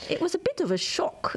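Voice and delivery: high-pitched New Zealand attempt that lands Scottish; mangled Scottish-sounding New Zealand accent; botched Kiwi accent. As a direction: try Kiwi and drift into Scottish.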